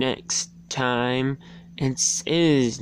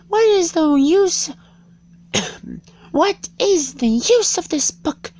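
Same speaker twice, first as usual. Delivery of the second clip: put-on much higher, louder little-girl Alice voice; high girl's voice for Alice; 6 dB louder than usual. A narrator's reading. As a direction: pitch right up as a bored young Alice. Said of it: "Thought Alice".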